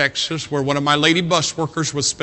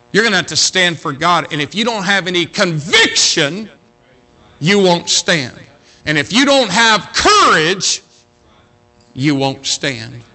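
rising preacher's exhortation, louder, slowing down; preaching; 3 semitones higher, 4 dB louder than usual. Acted. Preacher urging young people to stand for God, rising exhortation. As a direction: drive it home to the young people.

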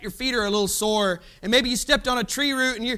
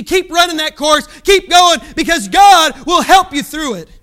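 full-force preaching shout, louder; 3 semitones higher, 7 dB louder than usual. Real, not acted. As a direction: drive the encouragement home at the top of your voice. Climax of the exhortation.

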